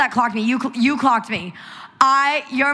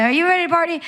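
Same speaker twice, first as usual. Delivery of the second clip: high-pitched performer's call; stage shout-out; 8 semitones higher, much faster than usual. Retold or imitated. Guest quotes their own line from performing on stage, high-pitched hype. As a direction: pitch it up like hyping a crowd. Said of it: "and then I say".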